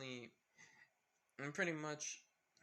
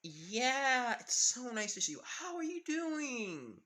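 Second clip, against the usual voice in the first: forced cheerful greeting; 9 semitones higher, 4 dB louder, slower than usual. Acted. Karin greets Toga with fake cheer.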